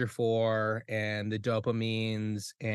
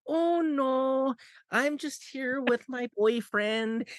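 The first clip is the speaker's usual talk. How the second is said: high, slowed northern Minnesotan mom voice; a much higher, sweet Minnesota mom drawl; mock Minnesotan mom accent, high and drawn out; 12 semitones higher, slower than usual. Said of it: "the most fucking northern Minnesotan mom".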